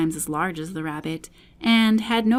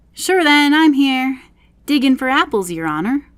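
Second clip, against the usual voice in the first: higher, louder Irish servant voice; Irish accent; 6 semitones higher, 7 dB louder than usual. A narrator's reading. Pat answering the rabbit from the garden, Irish accent. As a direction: answer his master in a broad Irish lilt. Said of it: "a voice she had never heard before".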